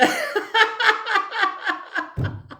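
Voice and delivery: shrieking high laugh; high laughter. Shown as a demonstration. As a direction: let a squealing laugh burst out. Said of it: "On y va". (éclat de rire très aigu)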